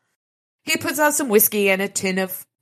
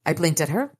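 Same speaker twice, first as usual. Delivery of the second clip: ordinary delivery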